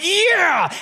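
put-on very high child's "yeah"; a small boy cheering yes; excited little boy. Son answers his dad.

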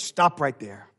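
ordinary talk, nothing marked

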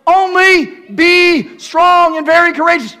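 high, loud proclamation; booming command. Acted. Preacher thundering the Lord's command to the congregation. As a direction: ring out God's charge.

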